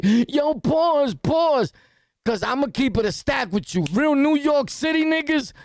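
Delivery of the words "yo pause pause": exaggerated, high-pitched mocking callout; mocking imitation. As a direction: mimic someone calling out pause. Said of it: "You never hear me be like".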